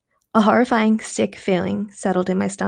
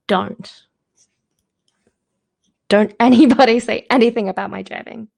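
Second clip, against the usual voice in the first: joking aside; 3 semitones higher, 4 dB louder than usual. Real, not acted. Reader's joking aside to listeners.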